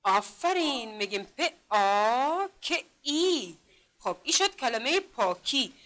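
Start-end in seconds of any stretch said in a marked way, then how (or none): 1.2-3.6 پ، آ، ک، ای sound by sound, آ and ای held long; slow separated sounds پ، آ، ک، ای. Shown as a demonstration. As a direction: stretch the vowels in پ، آ، ک، ای. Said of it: "اگر این را صدا کشی کنیم".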